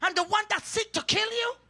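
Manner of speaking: preacher's rising cry; fiery preaching. Acted. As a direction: thunder the verse out to the church. Preacher rebuking the king.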